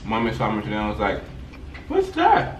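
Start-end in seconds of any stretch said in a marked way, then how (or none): 1.9-2.6 surprised mom. Retold or imitated. Mom's surprised question, retold.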